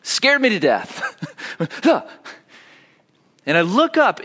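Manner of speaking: normal talking voice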